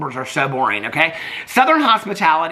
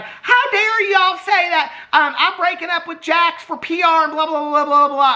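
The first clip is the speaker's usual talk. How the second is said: mocking Southern accent, pitched way up; mock Southern Brittany; 12 semitones higher than usual. Vlogger mocking Brittany's denial in a high Southern accent. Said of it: "so I can fake a Southern accent if I want to".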